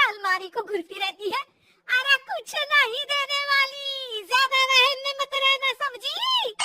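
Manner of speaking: loud, shouted tirade; furious scolding. Acted. Mother-in-law throws daughter-in-law out of room, furious scolding. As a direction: drive her out with a loud rant.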